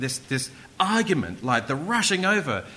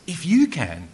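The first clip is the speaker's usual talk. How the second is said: louder "If you can" with "you" punched high; emphatic echo; 3 semitones higher, 6 dB louder than usual. Acted. Jesus turns it back on the father, with emphasis. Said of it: "turns it back on the father".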